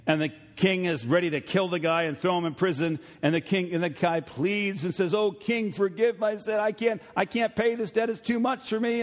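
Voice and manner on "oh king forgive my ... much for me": higher, begging servant voice; pleading servant. Retold or imitated. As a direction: plead with the king for mercy. Debtor pleads with king.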